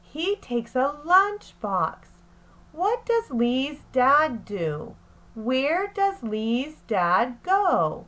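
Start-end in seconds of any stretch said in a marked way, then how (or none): none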